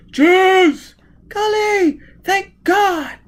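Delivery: put-on high, loud relieved cry of a young woman; gasping female voice. A narrator's reading. Gisbella clinging to Foyle in the river, shouting in relief.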